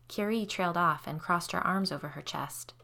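plain reading voice

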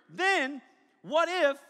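ordinary talk

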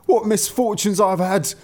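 high, aggrieved lament; vexed driver. A narrator's reading. Driver telling his wife of his losses, high vexed complaint. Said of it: "full of anger and vexation".

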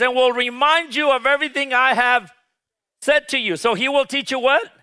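rally the congregation to answer back; fervent preaching. Acted. Call-and-response preaching, raised voice.

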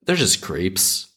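slow, deliberate, leaning on they're; slow and deliberate, weight on they're; slow emphatic. Shown as a demonstration. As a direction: spell it out slowly. Repeating line for emphasis.

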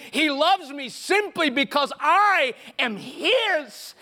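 high, loud, slow preaching climax; full-force preaching. Acted. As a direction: drive the point home to the congregation.

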